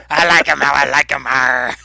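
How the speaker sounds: hammed-up pirate with a closing "arr". Game master redoes her line for laughs in a hammed-up pirate voice. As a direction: play it up as a joke pirate. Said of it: "not my pirate voice".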